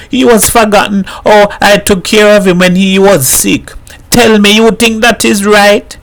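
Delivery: high, pleading lament; crying. A narrator's reading. Missus Herrera weeps to the mother, high and pleading. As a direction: plead through tears. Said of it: "broke down and cried like a girl".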